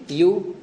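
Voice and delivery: "यू" with ई and ऊ run together; ई-ऊ मिलाकर. Shown as a demonstration. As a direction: say ई and ऊ merged into one syllable, "यू". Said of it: "ई और ऊ मिला करके". ("यू", ई और ऊ को मिलाकर एक ध्वनि में)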